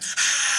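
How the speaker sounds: mock menacing gasps, without words; Death Eater noises. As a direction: imitate creepy rasping breaths. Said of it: "they're like".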